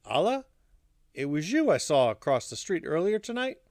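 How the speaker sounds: usual speaking voice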